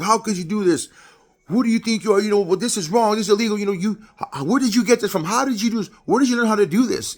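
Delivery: high, loud, imitated parental tirade; yelling father. Father finds drugs and confronts son, acted angry yelling. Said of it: "his father yelling at him".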